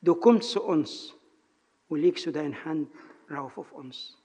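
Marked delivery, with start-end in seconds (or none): none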